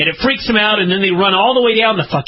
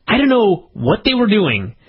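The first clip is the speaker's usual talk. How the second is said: ordinary talk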